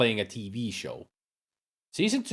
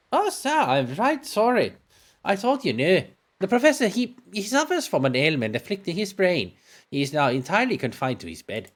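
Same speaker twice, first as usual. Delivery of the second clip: higher-pitched, sorry housekeeper; apologetic housekeeper; 9 semitones higher than usual. Acted. Housekeeper telling visitors of the professor's illness, apologetic. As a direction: break bad news apologetically in a high voice.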